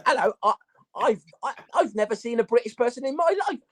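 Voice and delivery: high, fast hammed-up British accent, Cockney "Hello, governor"; mock British accent. Rapper mocking opponent's fake British accent, high hammed-up British accent. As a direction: parody a Londoner the way the opponent did. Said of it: "that accent that he was doing".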